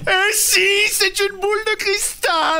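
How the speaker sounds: very high, drawn-out proclamation; theatrical reveal. Acted. Actor revealing his crystal ball to doubters, grandly theatrical. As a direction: unveil it like a stage showman.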